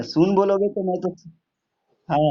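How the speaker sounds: ordinary delivery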